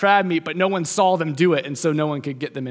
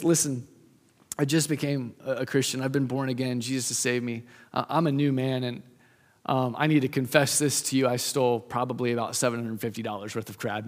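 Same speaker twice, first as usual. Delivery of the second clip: soft, earnest confession, voice dropping low; hushed confession; 6 semitones lower, 6 dB quieter than usual. Retold or imitated. Dan confessing to his supervisor, hushed and earnest. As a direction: confess it quietly, like admitting a shameful secret.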